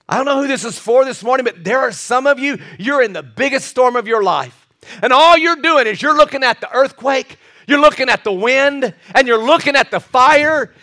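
high, driving pulpit fervour; full-force preaching. Real, not acted. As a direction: press it urgently on the congregation.

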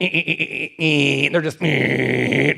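low droning eh eh eh like a machine; imitated machine buzz. Pastor imitating the radiation machine to the congregation, low buzzing drone. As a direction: mimic the radiation machine blasting.

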